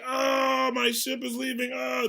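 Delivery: high crying wail, hammed up. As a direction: wail like a man watching his ship sail away. Circus owner wails as ship leaves.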